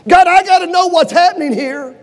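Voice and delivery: loud, urgent plea at God; desperate demanding cry. Retold or imitated. Preacher voicing Elijah demanding an answer from God, loud and urgent. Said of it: "grabs him by the lapels and says".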